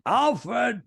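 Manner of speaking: put-on high, far-carrying call in a thick accent; father's accented holler. Son showing off his father's call, high accented holler. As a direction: holler the name like dad across a diner. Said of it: "knew my father love it".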